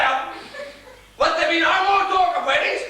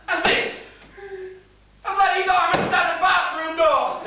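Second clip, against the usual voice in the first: sarcastic shouting; 5 semitones higher than usual. Acted. Father yelling sarcastically at his daughter through the door. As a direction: yell mockingly at the locked door.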